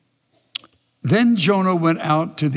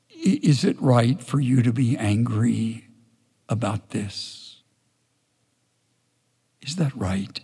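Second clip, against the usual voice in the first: gentle God; 5 semitones lower than usual. Acted. God pulling the angry prophet close, gentle and low. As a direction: ask like an arm around his shoulder.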